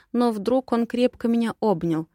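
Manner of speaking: ordinary delivery, nothing marked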